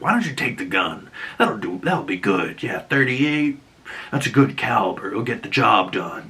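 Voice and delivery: lightly voiced Dex nudging a caller toward the gun; sinister coaxing voice. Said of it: "he's like".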